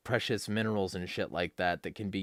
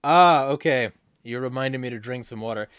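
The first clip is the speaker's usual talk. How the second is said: usual speaking voice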